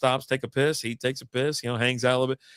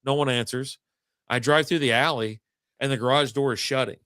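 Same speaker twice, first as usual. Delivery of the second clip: ordinary talk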